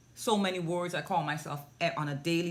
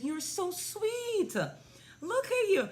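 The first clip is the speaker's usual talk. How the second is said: cooing praise; 14 semitones higher than usual. Retold or imitated. Praising a pouting three-year-old on video call, high cooing baby talk. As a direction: gush sweetly as if to a toddler.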